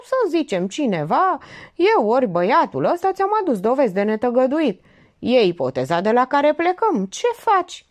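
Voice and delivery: high, loud argumentative voice; lecturing husband, insistent. Acted. Man arguing his case to Getuța, insistent.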